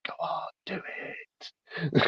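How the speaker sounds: very soft, drawn-out whisper; whispering inner beast. Acted. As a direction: whisper it slowly, like the beast urging from inside. Voicing the player's inner beast.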